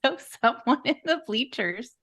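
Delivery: normal talking voice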